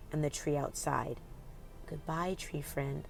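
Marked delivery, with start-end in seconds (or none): 1.9-3.0 soft, hushed goodbye; whisper. A narrator's reading. Girl softly bidding her tree goodbye. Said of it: "I whisper".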